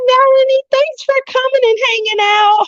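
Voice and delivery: high sing-out goodbye; effusive farewell. Real, not acted. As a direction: call out thanks way up high to someone leaving. Calls goodbye to departing viewer.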